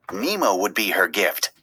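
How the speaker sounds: ordinary delivery, nothing marked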